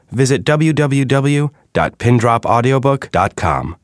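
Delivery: normal talking voice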